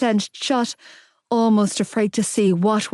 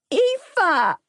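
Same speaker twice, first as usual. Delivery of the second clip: put-on high child's cry of her name; tearful small child; 10 semitones higher than usual. A narrator's reading. Small boy running to her in tears, crying out. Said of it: "came barreling towards me with tears streaming down his cheeks".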